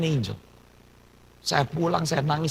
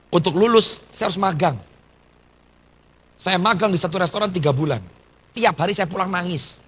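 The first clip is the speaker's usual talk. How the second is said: normal talking voice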